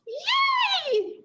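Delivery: put-on very high, thrilled little-girl cheer; excited cheer. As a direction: squeal it with delight. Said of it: "said Annie".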